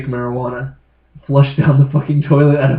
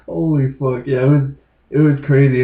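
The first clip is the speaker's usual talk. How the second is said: ordinary delivery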